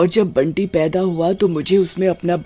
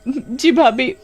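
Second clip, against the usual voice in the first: high, tearful begging; whimpering plea; 7 semitones higher, slower than usual. Acted. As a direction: cry out for mercy. Kamini caught, begs. (माफ़ी माँगती रोती कामिनी)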